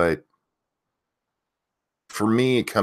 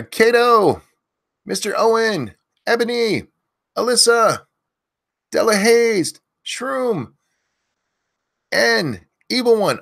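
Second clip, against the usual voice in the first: yelling names; 13 semitones higher, slower than usual. Real, not acted. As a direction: belt each viewer's name out. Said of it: "if you want me to yell your name".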